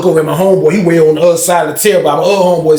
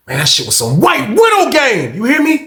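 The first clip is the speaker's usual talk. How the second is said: excited shout; 7 semitones higher than usual. Real, not acted. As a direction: shout it out in amazement. Hyped about the weed picture.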